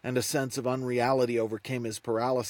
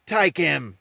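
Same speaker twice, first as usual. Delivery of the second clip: loud, higher-pitched outburst; yelled command; 4 semitones higher, 6 dB louder than usual. A narrator's reading. Rebus breaks in on Nate, no longer able to take it, yelling. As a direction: yell the order, breaking in abruptly.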